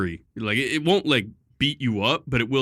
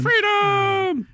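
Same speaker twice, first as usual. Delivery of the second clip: mock freedom shout; 4 dB louder than usual.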